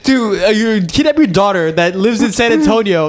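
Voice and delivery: high-pitched, worked-up comic disbelief; incredulous rant. Retold or imitated. Son ranting at mom about the kidnapping claim, incredulous. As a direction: lay out the absurdity in a high, insistent voice. Retold rant at mom's gullibility.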